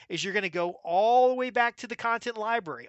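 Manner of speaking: usual speaking voice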